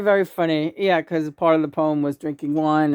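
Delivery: normal talking voice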